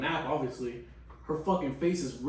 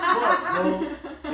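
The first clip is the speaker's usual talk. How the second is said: laughing despair; 12 semitones higher than usual. Retold or imitated. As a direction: squeal it out through laughter.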